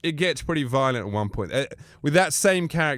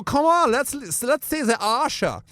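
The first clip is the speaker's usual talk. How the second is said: much higher coaxing Austrian voice; Bruno voice; 10 semitones higher than usual. Retold or imitated. Bruno coaxing the wrestlers, in a high coaxing voice. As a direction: wheedle like a flirty Austrian. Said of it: "I go".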